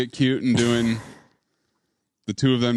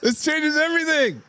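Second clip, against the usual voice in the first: thrilled; 12 semitones higher than usual. Real, not acted. Reacting to the discovery.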